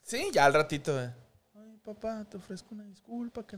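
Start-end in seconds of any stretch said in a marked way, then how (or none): 1.5-3.6 high, soft mock-child voice; quick little falsetto sorry; mimicked son apologizing. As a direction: imitate a kid's small, hurried apology. Father mimics son's reluctant apology.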